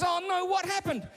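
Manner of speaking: alarmed father. Acted. Father hearing daughter's story, high-pitched mock alarm. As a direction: demand the next detail as a worried father. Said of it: "And Mike goes".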